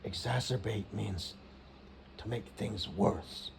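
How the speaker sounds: low, hushed, slow explanation; leaning in close. Retold or imitated. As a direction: spell it out slowly under your breath. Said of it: "she kinda leans in".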